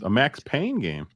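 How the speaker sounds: usual speaking voice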